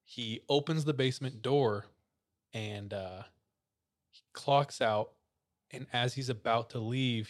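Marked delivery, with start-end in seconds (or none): none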